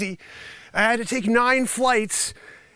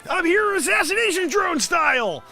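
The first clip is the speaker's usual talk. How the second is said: excited shout; 8 semitones higher than usual. Real, not acted. Hyping up his fake report.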